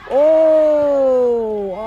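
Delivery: loud, high excited shout. Real, not acted. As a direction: burst out at the near goal. Big chance in the match.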